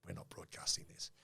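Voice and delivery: soft unvoiced whisper of "we're not broadcasting this"; whispered refusal. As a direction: whisper it like a secret veto. Producers refusing the episode.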